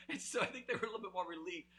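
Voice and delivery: talking fast through laughter; quick, laughing wrap-up of the prank story. Real, not acted. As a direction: rush the words while still laughing.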